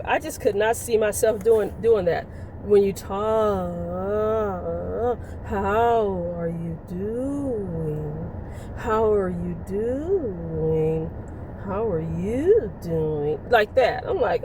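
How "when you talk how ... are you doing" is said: slow, low, wavy seductive drawl; siren voice. Shown as a demonstration. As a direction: let the words roll out like a wave. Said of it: "Let me see if I can give a demonstration".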